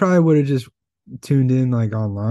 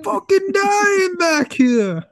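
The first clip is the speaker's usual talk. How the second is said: put-on very high, stretched-out movie accent; Reservoir Dogs accent; much slower than usual. Co-host joining the failed Biden bit, mock-agonized Reservoir Dogs accent. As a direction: wail it slowly in a hammy Reservoir Dogs accent. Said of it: "It turned into Reservoir Dog accent".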